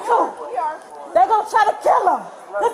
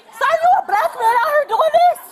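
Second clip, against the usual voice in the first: indignant disbelief; 6 semitones higher than usual. Real, not acted. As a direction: call him out in open disbelief. Woman confronting officer in disbelief.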